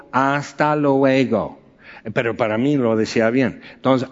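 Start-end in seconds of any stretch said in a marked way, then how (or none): 0.1-1.6 put-on slow, stretched Hasta luego in a gringo accent, luego as loego. Telling of a Spanish class, Hasta luego in a slow gringo accent. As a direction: drag out Hasta luego like an American learner. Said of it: "estaba diciendo muy gringo".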